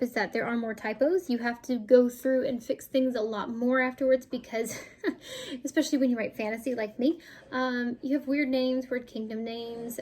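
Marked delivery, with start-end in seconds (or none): 4.8-5.6 soft high laugh. Real, not acted. Laughs about fantasy names.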